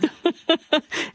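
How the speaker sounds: amused burst of laughter. Real, not acted. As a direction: laugh at the co-host's teasing. Joking reply to devil dress remark.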